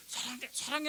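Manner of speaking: imitating a doting husband, high; husband's tender murmur. Husband following the gurney, mock doting murmur. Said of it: "하면서 막 따라가는데".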